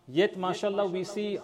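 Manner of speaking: ordinary talk, nothing marked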